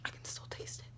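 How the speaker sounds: very faint, voiceless whisper; whispered, sickened aside; disgusted whisper. Real, not acted. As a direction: barely get it out in a whisper. Disgusted after tasting something rotten.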